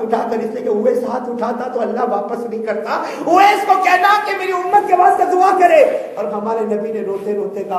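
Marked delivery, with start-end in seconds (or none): 3.2-5.8 fervent preaching cry. Acted. Preacher stressing the Prophet's request.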